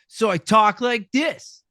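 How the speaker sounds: put-on high, loud, drawn-out obnoxious ten-year-old; bratty kid. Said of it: "an obnoxious, bratty, uh, ten-year-old".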